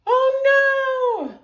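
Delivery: high, loud wail of dismay; long high-pitched panicked yell; screaming. A narrator's reading. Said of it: "screamed George".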